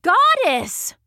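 high-pitched exclamation of awe; awed cry. A narrator's reading. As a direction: cry out to a goddess who has just appeared. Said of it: "the king cried".

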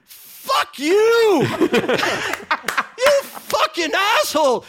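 high, loud laughing cursing; hammed-up outraged yell; mock furious shout. Billy yelling at Marie over the mic, high, loud and laughing. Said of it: "he goes".

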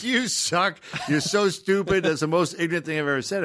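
high, piling-on insults; mock online abuse. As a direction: hurl insults as an imitated commenter. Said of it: "it went from thinking I was helping".

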